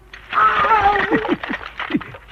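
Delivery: loud, high manic cackle; a wild triumphant laugh; villainous laugh. Acted. Villain gloats atop the bell tower with a manic cackle.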